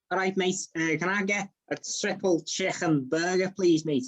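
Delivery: Scouse customer ordering food; lilting Liverpool Scouse; Scouse accent. Shown as a demonstration. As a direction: put on a chummy Scouse accent. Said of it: "Well, I can do Scouse".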